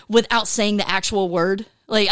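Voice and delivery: usual speaking voice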